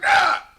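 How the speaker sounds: a high, loud shouted "ah"; mock triumphant yell. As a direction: let out a psycho war cry. Psycho taunting his Risk pieces.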